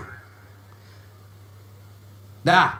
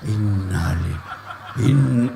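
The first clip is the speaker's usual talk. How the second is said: low, slow innalillah said to his face, half laughing; wrong way; 13 semitones lower, slower than usual. Shown as a demonstration. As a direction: recite it low while staring, mockingly. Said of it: "Jangan kau innalillah, kau tatap wajahnya". (innalillah rendah sambil menatap)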